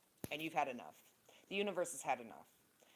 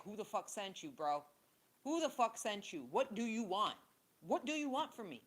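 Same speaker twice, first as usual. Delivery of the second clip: higher, louder mock confrontation at an unwanted visitor; exasperated confrontation; 6 semitones higher, 4 dB louder than usual. Imitating herself confronting fake lovers, higher and exasperated. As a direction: throw the challenge at them, exasperated. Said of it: "I'm tired of being like".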